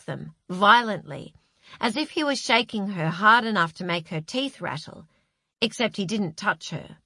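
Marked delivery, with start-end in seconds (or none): none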